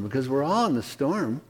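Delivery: ordinary talk, nothing marked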